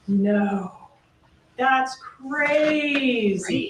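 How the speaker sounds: crazy drawn out very slowly with a rise; quoting his odd stretched crazy. Retold or imitated. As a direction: let crazy drag and lift at the end. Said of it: "adding the emphasis on that syllable".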